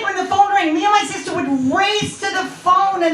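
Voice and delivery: ordinary talk, nothing marked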